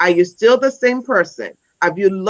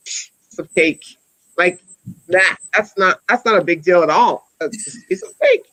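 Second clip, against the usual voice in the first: scoffing. Retold or imitated. Scoffing at a class of eight.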